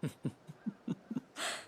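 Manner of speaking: soft breathy laugh at his expense; airy mocking laugh. Courtesan laughing at a Branded's request, airy and mocking. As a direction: laugh him off lightly.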